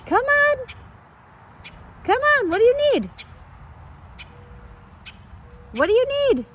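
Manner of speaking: high sing-song coaxing; coaxing pet voice. Real, not acted. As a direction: sweet-talk the bird down. Coaxing the bird sweetly.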